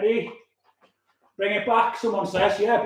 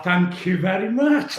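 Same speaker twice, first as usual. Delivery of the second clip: usual speaking voice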